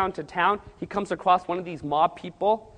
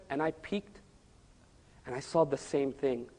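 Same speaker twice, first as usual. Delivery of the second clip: softer, hushed retelling; hushed peek; 4 semitones lower, 9 dB quieter than usual. Retold or imitated. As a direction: hold the breath, speak low as if peeking. Fearful peek into the chapel.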